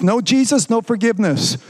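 usual speaking voice

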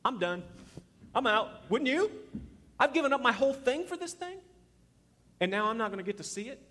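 high, loud incredulous protest; acted indignation. Moses giving up on the promised land, acted indignant disbelief. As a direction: climb from quitting into outraged questioning.

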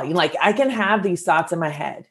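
ordinary delivery

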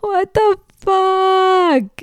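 very high, drawn-out mock outrage; exaggerated disbelief. Wife reliving the hot donut shock, high drawn-out mock outrage. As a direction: stretch it out high in hammed-up disbelief.

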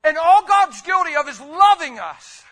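loud impassioned preacher; preaching at full force. Acted. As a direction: build it like a revival sermon.

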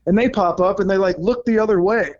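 usual speaking voice

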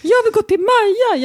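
put-on high whiny kid voice; high child begging to see Maja; imitating child whining. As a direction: whine it like a four-year-old. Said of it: "står och säger".

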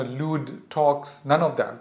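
ordinary delivery

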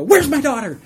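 high, loud panicked question; sudden alarm. Retold or imitated. Father waking from a faint, loud and alarmed about his daughter. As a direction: snap awake and look for your child.

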